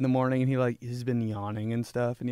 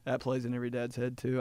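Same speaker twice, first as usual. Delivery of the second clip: ordinary talk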